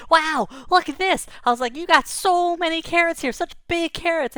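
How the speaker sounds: high, loud wonder; delighted at the harvest; excited. Retold or imitated. Boy showing rabbit the carrots, high and excited.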